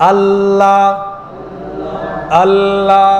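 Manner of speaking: আল্লাহ intoned on one note with a held L; slow আল্লাহ, tongue resting on the palate for the L. Shown as a demonstration. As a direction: sustain both the L and last vowel of আল্লাহ. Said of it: "মুখের তালু এখানে লাগছে".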